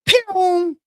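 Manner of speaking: high quick zap noise; cartoon vanishing sound; pew sound effect. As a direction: make him vanish with a pew. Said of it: "he'll disappear".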